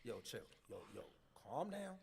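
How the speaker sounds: soft, low plea to chill; hushed attempt at calming someone; restrained pleading. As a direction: hold back and quietly defuse it. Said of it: "really trying to not take it there".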